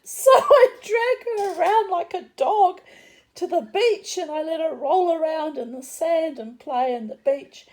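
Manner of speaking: high, loud, comically exasperated retelling; animated protest. Retold or imitated.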